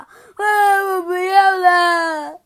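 slow, loud wailing protest; crying shout. Acted. A friend fleeing the mess, wailing. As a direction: drag it out in a tearful howl.

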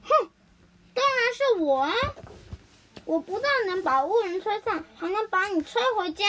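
haughty wind boasting; scoff, then brag with force; proud, emphatic storybook boast. A narrator's reading. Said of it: "风骄傲地说".

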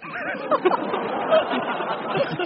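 squeaky high laugh falling away; high giggle. Real, not acted. Storyteller laughs at his own joke, high and squeaky.

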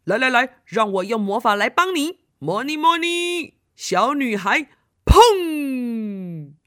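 lightly voiced young wizard, punchy; eager kid magician; big brother casting magic. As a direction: chant the spell, then a loud booming bang.

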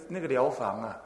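ordinary talk, nothing marked

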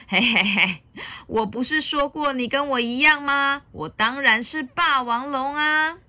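hammed-up dinosaur boast; gloating Tyrannosaurus. A narrator's reading. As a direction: reveal yourself with villainous glee. Villain reveals himself.

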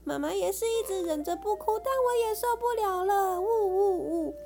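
high teary voice; mother crying along with her son. A narrator's reading. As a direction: weep while talking. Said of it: "妈妈也哭了".

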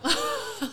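very high burst of giggling; short laugh. Real, not acted. Guest laughs off nearly swearing, short high laugh. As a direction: break into a quick, high laugh.

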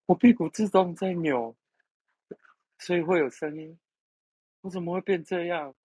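high, bewildered mock panic. Caller alarmed at his own wriggling, high bewildered voice. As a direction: squeak it out high, lost and confused. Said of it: "惊呼了".

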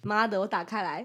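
usual speaking voice

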